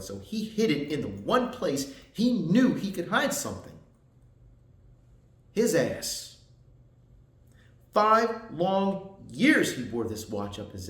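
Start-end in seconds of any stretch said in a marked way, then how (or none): 0.0-6.4 louder, higher, building to ASS; emphatic reveal. Acted. Veteran telling a boy where his dad hid the watch, emphatic. 7.9-9.8 FIVE, LONG, YEARS each stretched slow and loud; drawn-out emphasis. Acted. Veteran impressing a father's ordeal on his son, drawn-out emphasis.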